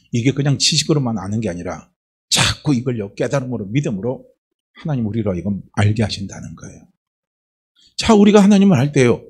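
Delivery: ordinary delivery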